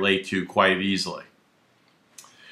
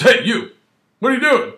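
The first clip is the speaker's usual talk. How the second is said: much higher, louder demanding call; barking cop; 11 semitones higher, 4 dB louder than usual. Retold or imitated. Officer challenging a pedestrian, high and loud. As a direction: snap it out like a suspicious officer. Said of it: "He goes".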